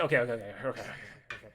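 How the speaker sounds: okays sinking to a frightened whisper; nervous fading okays. Retold or imitated. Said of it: "she's like".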